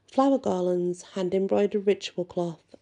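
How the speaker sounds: ordinary talk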